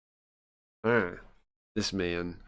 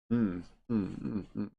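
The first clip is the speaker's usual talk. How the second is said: soft, fading lizard hums; an imitation of the cold inspector, humming softly; 7 dB quieter than usual. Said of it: "That's my impression of Inspector Nzami".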